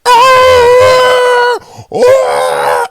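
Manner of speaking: high shrieking wail; crazy noise. Retold or imitated. Deaf aunt running off mid-family function, wordless shriek. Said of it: "she make this crazy noise like".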